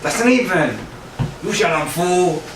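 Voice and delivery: high-pitched imitated comeback; mock angry retort. Buddy retorts in acted quarrel.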